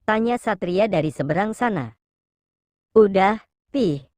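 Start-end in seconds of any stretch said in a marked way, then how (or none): 2.9-4.1 slow, halting reply; mildly flustered, drawn out with a break; stammering. A narrator's reading. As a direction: hesitate slowly over the words. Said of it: "Sofia tergagap".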